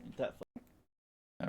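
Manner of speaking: whispering the line back as a mic test. Shown as a demonstration. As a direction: breathe "Ain't that fun?" out as a slow whisper. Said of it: "do an audio check".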